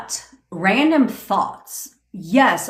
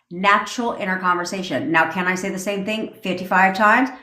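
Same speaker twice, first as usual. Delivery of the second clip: ordinary talk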